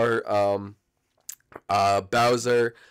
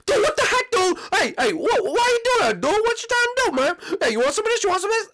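very high, squawking outrage; outraged [name]; 3 dB louder than usual. Acted. [name] startled awake by a prank, angry squeal. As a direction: squeal threats in a panic-pitched rage.

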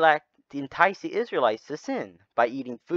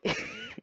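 laughs; 10 dB quieter than usual. Real, not acted. Breaks up laughing mid story.

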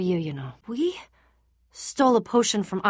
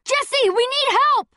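high urgent cry for help; desperate shout; 6 dB louder than usual. Acted. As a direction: yell across the battlefield. Battle, calling for help.

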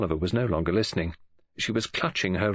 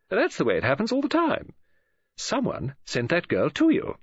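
higher-pitched, emphatic chatterer; opinionated woman friend; 10 semitones higher than usual. Acted. Woman friend explains word of mouth to the detective, emphatic. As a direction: rattle on, explaining as if it were obvious.

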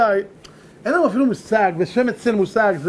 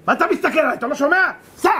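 higher, sharp angry rebuke; angry yelling; 6 semitones higher than usual. Acted. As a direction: chase the messenger off angrily. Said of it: "שמעון בכלל צעק עליי".

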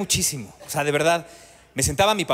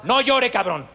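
higher mock shout, slow at the end; hammed-up angry dad; scolding parent; 4 semitones higher than usual. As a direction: bark at the crying kid to stop. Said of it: "nos tenían prohibido".

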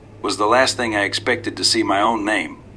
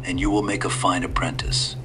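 lightly voiced old man, calm and sly; a hint of a courteous old stranger; 3 semitones lower, 4 dB quieter than usual. A narrator's reading.

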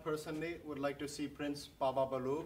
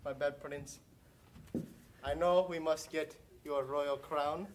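ordinary talk

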